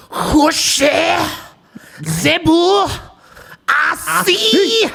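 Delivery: high, loud, hammed-up fake French; mock French accent. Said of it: "absurd possible fucking accents ever".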